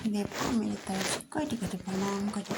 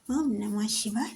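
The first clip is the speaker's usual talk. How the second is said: ordinary talk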